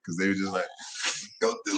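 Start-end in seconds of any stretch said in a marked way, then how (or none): 1.4-1.8 lightly voiced, higher pusher; playful smuggler impression; mock smuggler voice. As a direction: egg him on in a high mock voice. Voicing the smugglers egging on.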